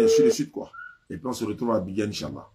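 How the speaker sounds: usual speaking voice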